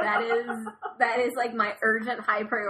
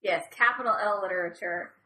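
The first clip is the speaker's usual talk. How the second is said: ordinary talk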